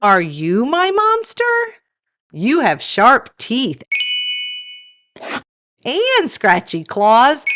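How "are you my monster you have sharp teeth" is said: ordinary delivery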